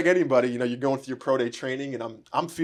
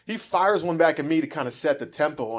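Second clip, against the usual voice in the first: ordinary talk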